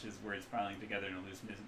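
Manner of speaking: usual speaking voice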